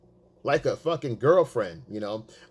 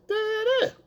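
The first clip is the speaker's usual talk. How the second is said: high nonsense babble as her; mimicked girlfriend chatter. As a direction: imitate her chatting away, pitched way up. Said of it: "high pitched".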